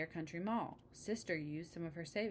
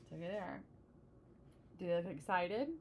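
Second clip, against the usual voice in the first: plain reading voice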